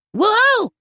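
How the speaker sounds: high, loud startled yelp. Acted. Marshall yelping as the kitten grabs his face. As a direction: yelp in sudden alarm. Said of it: "Marshall yelped".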